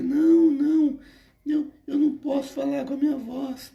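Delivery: high falsetto protest; imitating thin high voice. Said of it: "Porque ele falava muito fino".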